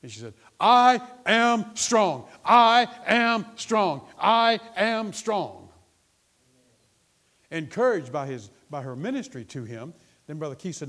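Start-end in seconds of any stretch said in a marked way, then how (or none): 0.6-5.7 slow, high, booming declaration; full-force, drawn-out triumphant shout; out loud. Retold or imitated. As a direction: imitate her shouting it word by word. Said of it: "she's talking out loud".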